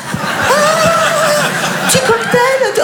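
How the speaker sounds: sudden high, giddy voice at the thought of a cocktail; gleeful cocktail daydream. Pretend. Exhausted mother dreaming of a cocktail, giddy high squeal. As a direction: squeal with delight at a drink. Fantasizing about barmaid cocktails.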